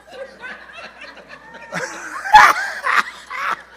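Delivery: big whooping laugh. Real, not acted. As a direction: throw back your head and howl with laughter. After hanging up the phone.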